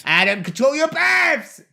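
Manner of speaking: high-pitched exasperated yell; squawked reprimand; scolding shout. Real, not acted. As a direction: yell at a friend in mock outrage. Scolding a burp.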